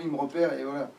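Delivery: ordinary delivery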